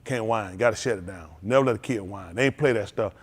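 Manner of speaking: ordinary delivery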